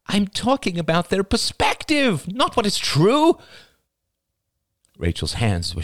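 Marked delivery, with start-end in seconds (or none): 0.1-3.4 Rachel protesting. A narrator's reading. Rachel protests her meaning.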